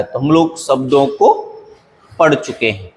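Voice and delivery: ordinary talk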